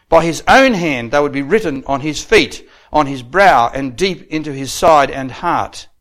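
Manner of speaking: loud, emphatic pulpit oratory; ringing sermon delivery, key words punched; declamatory preaching. Real, not acted. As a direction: drive each phrase home to the congregation. Preaches at raised force.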